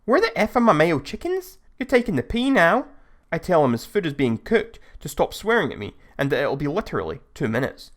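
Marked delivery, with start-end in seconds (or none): 0.0-2.9 raised, high angry Scouse voice; near shout. Retold or imitated. Customer barging up in the cashier's face, near-shouting. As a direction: push it to the edge of a yell. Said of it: "almost shouting now".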